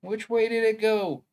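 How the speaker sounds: a quick first try at the Roger Rabbit henchman voice; cartoon henchman voice. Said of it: "the guy who did the voice in Who Framed Roger Rabbit".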